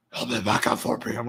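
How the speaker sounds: put-on manly voice, deliberately unsexy; fake man's voice. Religious woman disguising her voice to a male caller, fake manly voice. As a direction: imitate a woman faking a man's voice. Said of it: "she talks like that".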